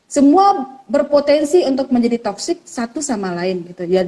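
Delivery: ordinary talk, nothing marked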